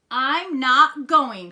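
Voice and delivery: loud, slow, high refusal; defiant cry. A narrator's reading. Froggy refusing the movie play date, loud slow defiance. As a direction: hammer each word out defiantly. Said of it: "cried Froggy".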